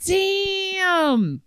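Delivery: high, stretched-out exclamation; stunned reaction. Real, not acted. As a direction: let it ring high and long, then fall. Reacting to the breakup story.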